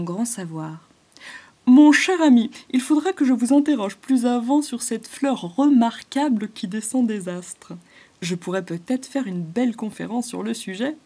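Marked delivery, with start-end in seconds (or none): 1.7-11.0 loud, higher learned professor voice; pompous scholar bird. Acted. Wise woodpecker plans a lecture for the python, pompous. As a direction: speak like a self-important scholar.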